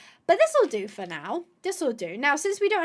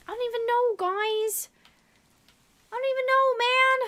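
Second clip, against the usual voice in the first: pitched way up, incredulous; can't believe what just happened; high exasperated I don't even know; exasperated disbelief; 11 semitones higher than usual. Real, not acted. Repeats in rising disbelief.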